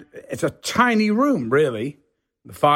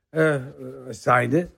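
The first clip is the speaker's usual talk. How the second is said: ordinary delivery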